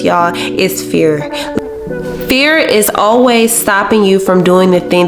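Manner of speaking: normal talking voice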